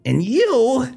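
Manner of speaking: high, giggling menacing villain; creepy villain voice. Acted. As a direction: gloat like an unhinged captor.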